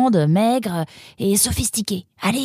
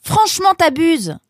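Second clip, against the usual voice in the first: raised, indignant complaint; exasperated; 4 semitones higher, 4 dB louder than usual. Acted. Roxane left to answer the door, exasperated. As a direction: let the annoyance ring out. Loses quarrel over the door.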